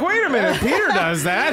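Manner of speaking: laughing while imitating her realizing about Peter; laughing imitation. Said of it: "She's like".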